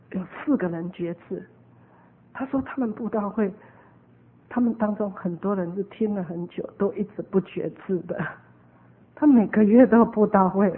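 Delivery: normal talking voice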